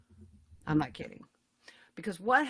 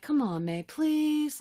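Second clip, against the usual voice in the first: coaxing, rising high on please; retold friendly pleading; wheedling plea; 8 semitones higher, much slower than usual. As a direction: beg with a stretched, pitched-up please. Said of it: "like that".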